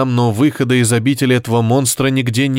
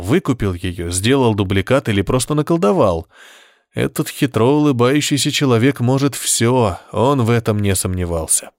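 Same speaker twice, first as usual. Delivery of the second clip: plain reading voice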